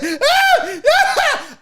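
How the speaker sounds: high imitation scream; exaggerated high-pitched yelps; mock victim shriek. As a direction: shriek in hammed-up alarm. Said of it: "she goes into victim mode".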